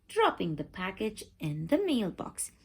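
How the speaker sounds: usual speaking voice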